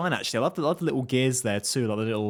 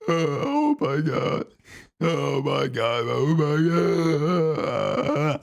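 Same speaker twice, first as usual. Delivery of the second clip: mock disbelief; 2 semitones higher, 3 dB louder, much slower than usual. Mock shock at character dying.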